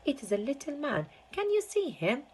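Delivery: put-on high, eager boy's voice; boy crying out to his grandfather; excited cry. A narrator's reading. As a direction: call out in wonder to grandpa. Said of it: "cried Charlie".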